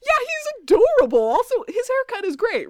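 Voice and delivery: high, loud mock gush; retold gushing. They now approve of his new body, high gushing. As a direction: gush over him, playfully exaggerated.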